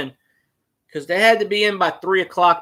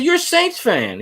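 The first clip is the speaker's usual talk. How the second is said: incredulous; 10 semitones higher than usual. Retold or imitated. Retold disbelief at fan.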